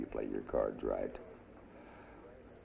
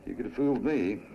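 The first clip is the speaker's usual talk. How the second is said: sarcastic; 10 semitones higher, 7 dB louder than usual. Acted. Sarcastic retort in argument.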